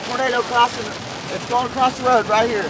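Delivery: high, loud, breathless storm report; excited shouting. Real, not acted. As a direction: shout it out as the tornado hits. Tornado crossing road beside chaser.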